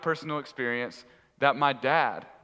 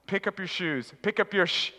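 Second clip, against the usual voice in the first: lightly voiced dad, pitched higher; imitating dad; 5 semitones higher than usual. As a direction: repeat it as a nagging dad's reminder. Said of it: "Just like my dad said".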